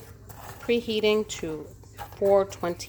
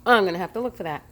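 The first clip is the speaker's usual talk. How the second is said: ordinary delivery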